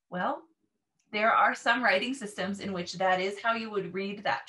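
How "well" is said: usual speaking voice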